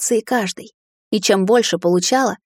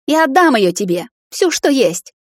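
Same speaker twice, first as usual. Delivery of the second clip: high, loud, emphatic promise; urgent, loud pledge; impassioned offer; 6 semitones higher, 4 dB louder than usual. A narrator's reading. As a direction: offer everything with raised voice. Daughter offers magic in confrontation.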